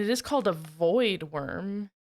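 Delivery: usual speaking voice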